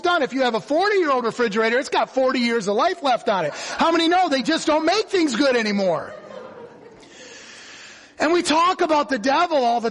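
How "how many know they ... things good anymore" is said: ordinary talk, nothing marked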